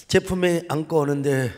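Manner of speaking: usual speaking voice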